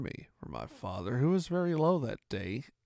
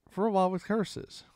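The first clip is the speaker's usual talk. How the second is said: plain reading voice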